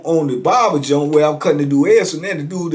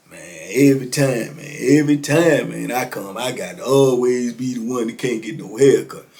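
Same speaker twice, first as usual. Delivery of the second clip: retold grumbling; 5 semitones lower, 3 dB quieter than usual. Customer grumbles about no haircut.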